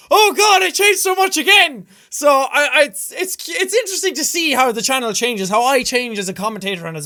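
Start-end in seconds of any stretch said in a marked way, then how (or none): 0.1-1.9 higher, louder groan of mock horror; mock dismay. Reacting to his old video with a higher, louder mock groan. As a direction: act appalled at your old self. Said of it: "I'm like".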